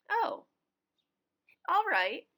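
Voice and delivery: put-on boy exasperated by Grandpa's answer. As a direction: throw up your hands at the useless answer.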